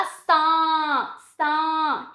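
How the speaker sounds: सा held long with a nasal aa; nasalised vowel. Shown as a demonstration. Teacher showing chandrabindu on सा, long nasal vowel. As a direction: sustain सा through nose and open mouth. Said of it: "सा पे चंद्र बिंदु लगा".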